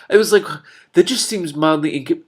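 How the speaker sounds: high and loud burst of glee; excited. Real, not acted. Reader excitedly explaining the pigeon joke, gleeful. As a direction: let the realisation burst out.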